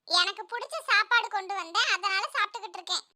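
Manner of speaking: put-on soft little-boy voice; schoolboy Jakku. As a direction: answer the teacher in a small, quiet voice. Pupil answering teacher at lunch. (சிறுவனின் மெல்லிய குரல்)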